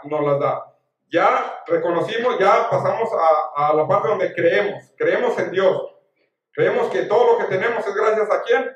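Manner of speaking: loud, high-pitched sermon delivery; impassioned preaching. Real, not acted. Preacher urging followers of Jesús to trust, impassioned preaching. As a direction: preach with force, driving each point to the congregation.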